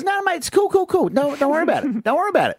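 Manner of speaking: put-on high, quick-fire mate reassuring a drunk; acted "don't worry" in a high voice; breezy reassurance. Mate soothes panicking drunk in joke.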